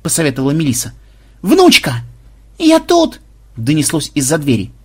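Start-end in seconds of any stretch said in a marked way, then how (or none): 1.4-2.0 high, loud summons; calling out. Acted. Rescuer calling the locked-up granddaughter, loud call. 2.6-3.2 very high, eager call; answering cry. Acted. Captive answering rescuers from behind door, high cry.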